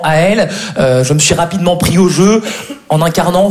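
normal talking voice

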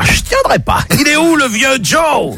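high, frantic trapper; desperate high plea. Acted. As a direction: beg high, at the end of his rope. Trapper can't wait. (trappeur désespéré, voix haute)

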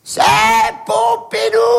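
high, stretched-out call; long, ringing call on high; voice from above. Retold or imitated. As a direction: announce yourself from far overhead. Unseen voice answers from on high.

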